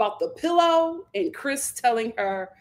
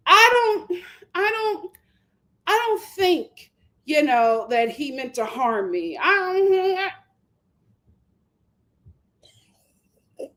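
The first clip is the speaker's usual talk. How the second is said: mock Huda; 4 semitones higher than usual. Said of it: "She does this".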